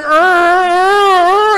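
building pained groans; mock groans of pain from a hot coffee splash. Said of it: "I'm just progressively going".

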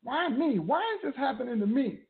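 high-pitched mild plea; acted questioning of God. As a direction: plead upward as the betrayed spouse. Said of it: "you might be saying".

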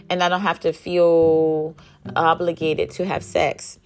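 usual speaking voice

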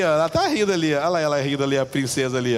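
normal talking voice

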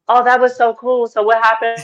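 a light airheaded fan voice; clueless fan. Retold or imitated. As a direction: play the oblivious friend who missed everything. Mocking a clueless moviegoer.

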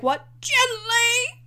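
mock-shocked squeal of a question; incredulous squeak. Buttercup stunned when Wesley says gently, high incredulous squeak.

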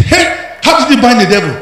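high, loud amazed asking; surprised question. Acted. Friend amazed asking the businessman how he won, loud.

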